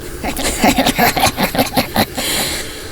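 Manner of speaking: eerie ghostly cackle; sinister, stuttering laugh; creepy laugh. Acted. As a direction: cackle slowly like a possessed girl. Ghost laughs through possessed girl.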